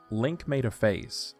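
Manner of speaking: normal talking voice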